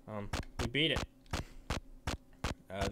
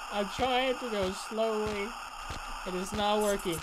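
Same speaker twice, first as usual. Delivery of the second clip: high-pitched exasperation; frustrated complaint; 6 semitones higher than usual. Real, not acted. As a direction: whine it higher, fed up. Plan fails while being chased.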